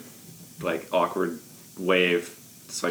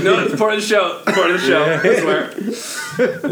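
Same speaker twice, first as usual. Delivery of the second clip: high, loud nervous insisting; panicked kids party host; 11 semitones higher, 6 dB louder than usual. Acted. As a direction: cover up the blunder fast. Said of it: "He's like".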